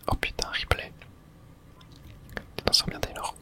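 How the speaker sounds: ordinary talk, nothing marked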